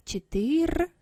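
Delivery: четыр cut off after the р, no е; shown without final е. Shown as a demonstration. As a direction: say четыр with no vowel at the end. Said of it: "четыр без е".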